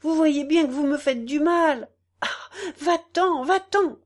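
high, loud cry of pain; husband crying out to his wife to leave; anguished shout. A narrator's reading. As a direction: drive her away, voice cracking high. Husband driving wife away.

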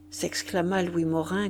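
ordinary delivery

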